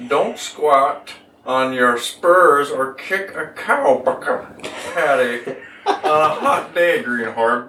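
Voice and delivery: slow low cowboy drawl; cowboy accent. Acted. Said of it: "the best I can do".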